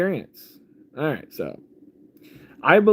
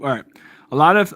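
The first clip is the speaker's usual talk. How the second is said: ordinary talk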